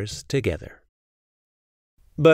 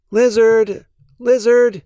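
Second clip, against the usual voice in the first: higher and louder, calling the lizard; higher, louder urgent call; calling out quickly; 9 semitones higher, 5 dB louder than usual. A narrator's reading. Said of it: "he called quickly".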